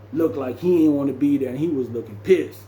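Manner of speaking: ordinary talk, nothing marked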